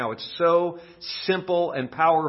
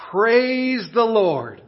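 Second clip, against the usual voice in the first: slow, high, drawn-out praise; elderly widow praising; 8 semitones higher, 4 dB louder, much slower than usual. Acted. Widow on her porch, slow joyful praise. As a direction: lift it up slowly to heaven. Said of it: "she would say".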